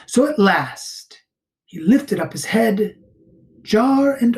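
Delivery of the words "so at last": plain reading voice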